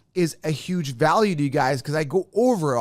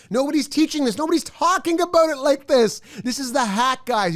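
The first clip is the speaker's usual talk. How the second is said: hyped pitch; 7 semitones higher than usual. Real, not acted. Hypes his draft guide.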